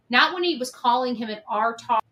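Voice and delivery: ordinary talk, nothing marked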